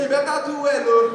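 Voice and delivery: loud threatening brother; loud warning. Retold or imitated. Elder brother threatening him, loud warning. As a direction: warn him loudly.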